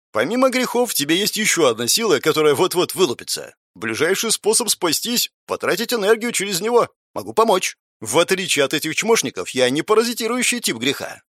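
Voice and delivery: put-on high, playful teenage boy; mischievous teen voice. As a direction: tease like a cheeky teenager.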